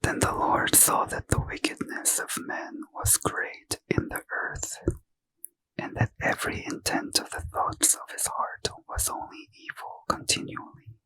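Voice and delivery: ordinary delivery, nothing marked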